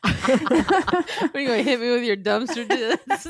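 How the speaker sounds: real giggles breaking into the words; laughing. Real, not acted. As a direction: push the question out through laughter. Cracking up at the joke.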